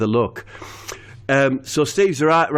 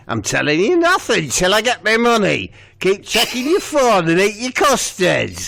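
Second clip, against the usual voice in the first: the lass refusing Steve, higher sharp voice; voicing the lass; 8 semitones higher than usual. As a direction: hold out for the money, higher.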